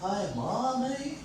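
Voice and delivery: put-on soft, slow little-kid voice; four-year-old child. Four-year-old talking to Mommy, imitated child voice. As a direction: greet mom like a small child. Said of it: "That's what a four-year-old says".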